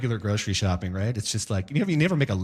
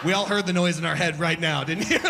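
usual speaking voice

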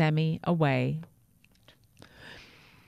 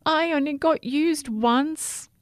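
wailing whisper; 9 semitones higher than usual. A narrator's reading. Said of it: "wailed a plastic bag".

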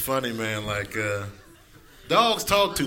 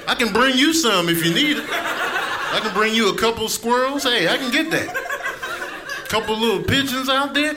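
yelling hungry cat; 5 semitones higher than usual. Retold or imitated. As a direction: scold the owner as a hungry cat. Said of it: "cats are yelling at you".

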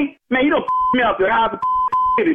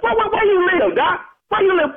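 heated demand; 7 semitones higher than usual. Real, not acted. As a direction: demand his address, fuming. Angry confrontation with prank caller.

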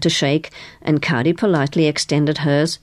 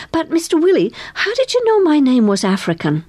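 high-pitched, keen questioning; eager; 11 semitones higher than usual. A narrator's reading. Girl questioning the carnival boss, eager. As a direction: ask keenly, pitched well up. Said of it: "she said eagerly".

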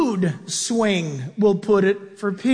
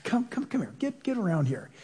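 much softer, gentle coaxing call; tender fatherly; 11 dB quieter than usual. Retold or imitated. Old Peter softly calls his flock to him, tender. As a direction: gather the children in with a father's heart. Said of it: "he just melts with his fatherly heart".

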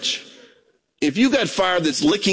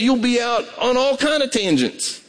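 normal talking voice

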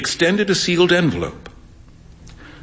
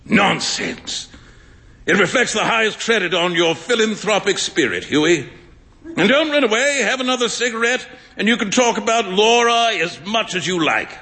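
amused, a touch higher, ribbing his pal; lightly voiced teasing friend; 6 semitones higher than usual. A narrator's reading. Painter Trevor ribs Hughie about the beggar, lightly amused. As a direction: tease him like an old friend who can't believe it.

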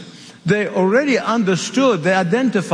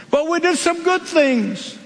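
higher, pleading protest; a higher, whiny plea; pleading foolish ones; 7 semitones higher than usual. Acted. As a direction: beg to be let in.

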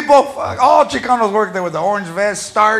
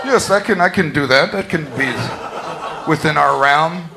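formal contractor; 6 semitones lower than usual. Acted. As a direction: sound overly professional. Contractor answering.

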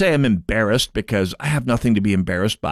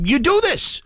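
sudden higher mocking voice; mock Minnesotan. Host mocking embarrassed ex-Minnesotans, higher mock voice. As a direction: squeak it out like a sheepish expat.

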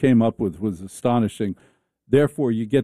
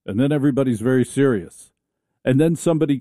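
normal talking voice

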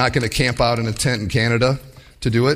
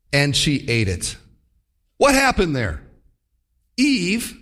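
ordinary talk

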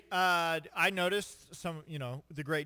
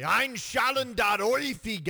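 hammed-up German, louder; mock German accent; 6 semitones higher, 6 dB louder than usual. Shown as a demonstration. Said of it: "you say".

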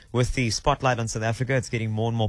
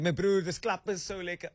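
higher, softer mock local-guy voice; imitating a local guy; 6 semitones higher, 4 dB quieter than usual. Host mimicking locals describing a snack, higher and softer. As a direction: toss it off like a casual local bro. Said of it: "usually they go like".

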